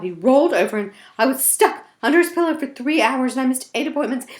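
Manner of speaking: high, fast indignant fairy voice; complaining tooth fairy. Acted. Tooth fairy complains about Melvin's head, high and fussy. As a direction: huff about the heavy head.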